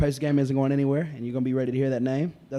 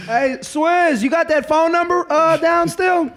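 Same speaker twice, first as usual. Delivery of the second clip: calling out; 13 semitones higher, 6 dB louder, slower than usual. Real, not acted. Calling out to [name] elsewhere.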